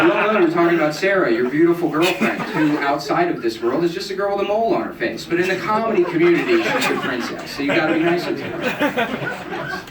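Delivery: hammed-up emcee; mock emcee voice. Comic addressing audience in character.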